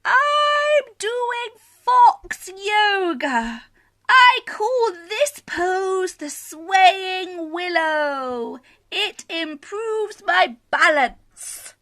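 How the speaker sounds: high, slow fox voice, proud of his yoga; a gentle, pleased little fox showing off a pose; high and slow, a fox explaining his yoga; calm Scaredy Fox. Acted.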